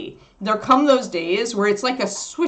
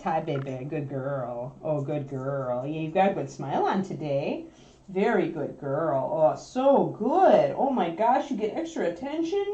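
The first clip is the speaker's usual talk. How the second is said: tender praise; 4 semitones lower, 6 dB quieter than usual. Real, not acted. Owner softly praising her calm puppy. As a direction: soothe the puppy gently.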